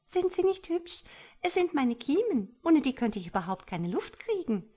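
high, pleased little voice; cheerful tadpole. A narrator's reading. Tadpole shows its gills to mother, cheerful high voice. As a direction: show them off merrily. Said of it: "fächelte vergnügt".

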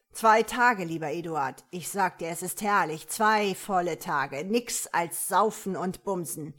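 loud, swaggering reply; boastful robber. A narrator's reading. Robber bragging to the commissioner about freedom, loud. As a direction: boast loudly across a distance.

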